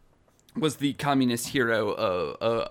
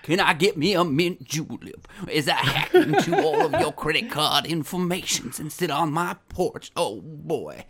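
Southern gentleman; 3 semitones higher than usual. Acted. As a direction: ham up a genteel Southern gentleman. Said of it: "this Southern gentleman".

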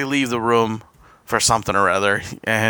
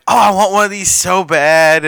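longing gush; 6 semitones higher, 5 dB louder than usual. Retold or imitated. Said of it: "tells his wife".

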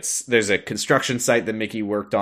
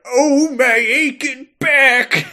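high, slow, louder groaning old-cartoon complaint; groaning Pete; 7 dB louder, slower than usual. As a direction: moan it out like a beaten-up cartoon villain. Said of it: "he's like".

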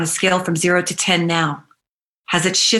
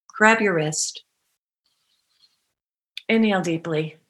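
guided meditation. Acted. Soft guided affirmations.

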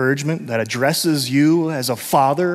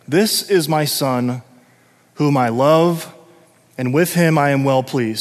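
solemn voice of God. Acted. God's voice from heaven.

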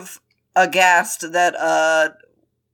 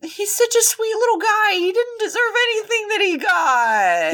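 high gushing pity; 11 semitones higher than usual. Real, not acted. Gushing pity for Wen Ning.

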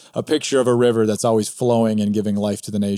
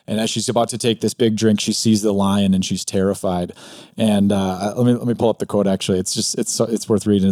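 ordinary talk

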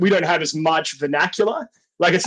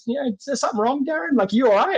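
boss asking if something's wrong; 6 semitones higher than usual. Retold or imitated. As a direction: ask gently like a worried boss. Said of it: "my boss at work sees that and goes".

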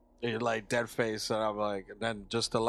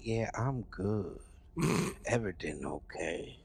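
slow, soft, imitated fake reassurance; low-key, drawn-out mock composure; uneasy backing away; 3 semitones lower, 7 dB quieter than usual. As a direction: act like nothing's wrong while edging out. Voices friend backing out of room.

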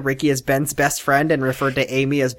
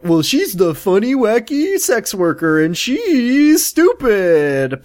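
higher, slow dumb-blonde voice; mocking the writers; 5 semitones higher, much slower than usual. Cohost mocking how writers see Betty, in a dumb drawl. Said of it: "they're like".

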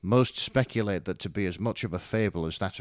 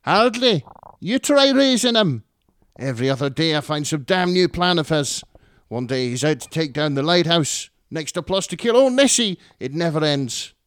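much higher and louder, yapping terrier voice; grating Scottish mum; 7 semitones higher, 9 dB louder than usual. Acted. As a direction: yap it like a cranky little terrier. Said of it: "Her voice was grating".

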